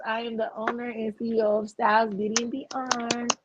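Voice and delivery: ordinary talk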